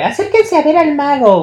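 high, drawn-out barker cry; hyped fairground barker. Retold or imitated. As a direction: summon the crowd like a fair barker. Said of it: "Así decía como de". (pregonero de feria exagerado)

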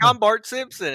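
high, loud cartoon boy voice; Bart Simpson. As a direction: imitate a cartoon boy announcing himself. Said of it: "does her Bart Simpson voice".

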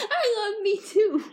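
squealing through giggles; laughing self-love joke. Real, not acted. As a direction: giggle the words out high.

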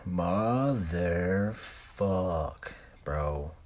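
long, slow swear at remembered pain; drawn-out groaned curse. Retold or imitated. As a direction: stretch the curse out very slowly. Reliving the pain of laser removal.

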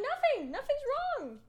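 high, loud mock-indignant reply; indignant retort. Retelling indignant reply to coworkers.

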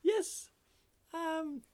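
very high, small squeaky voice; little frog voice. Acted. Frog answering the golfer, little high voice. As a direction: answer in a tiny high creature voice. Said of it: "And the frog says".

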